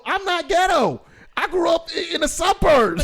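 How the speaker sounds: high, loud indignant denial; indignant protest. Retold or imitated. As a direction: protest hard, nearly shouting. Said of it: "And I go".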